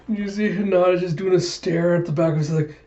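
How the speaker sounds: normal talking voice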